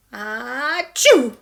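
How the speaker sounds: loud explosive sneeze; one loud sneeze; big sneeze. Acted. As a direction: let rip a huge achoo. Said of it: "He had a bad case of the sneezes".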